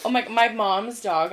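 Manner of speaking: ordinary talk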